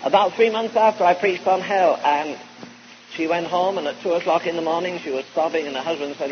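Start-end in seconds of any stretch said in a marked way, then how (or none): none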